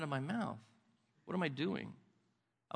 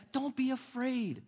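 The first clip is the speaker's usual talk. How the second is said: gentle reassurance; 7 semitones higher, slower than usual. Retold or imitated. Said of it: "he's like".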